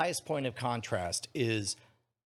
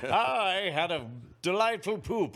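pompous orator; 6 semitones higher, 4 dB louder than usual. Pretend. Mock pompous speech.

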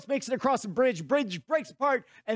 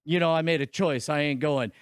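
lower, gravelly-free mock tough guy; mock movie-star hero, lower; gruff action hero; 7 semitones lower than usual.

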